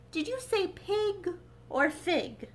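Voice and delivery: Cheshire Cat voice. Acted. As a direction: linger on pig and fig. Cat on a branch.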